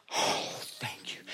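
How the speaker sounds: whispered relieved thank you; breathless thanks. Retold or imitated. Driver whispering relieved thanks to the cop, hushed. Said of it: "It's like".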